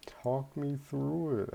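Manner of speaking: high, slow, drawn-out imitation. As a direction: mimic a girl's suggestive plea, stretched out.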